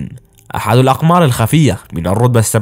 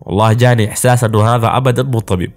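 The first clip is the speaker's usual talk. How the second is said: plain reading voice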